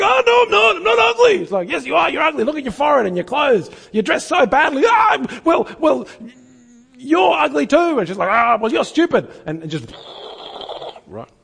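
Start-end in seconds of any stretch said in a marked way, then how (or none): 0.0-1.4 very high, loud, hammed-up protest; mock squeaky denial. Speaker retells bully exercise. 1.7-4.8 put-on sling the insults like a kid; mock taunting insults. Girl insults speaker in retelling. 4.9-8.0 very high, loud sputtering retort; mock flustered comeback. Man insults back in retelling. 8.3-9.3 mock insult. Girl wins insult exchange.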